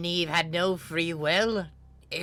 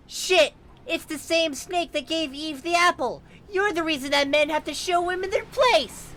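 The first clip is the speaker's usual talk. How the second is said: high, loud, indignant rant; shrieking at the snake, high and loud; furious outburst; 12 semitones higher, 4 dB louder than usual. Acted. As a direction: yell it in outrage. Gagged Mari ranting at the snake.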